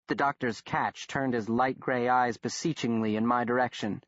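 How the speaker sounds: ordinary delivery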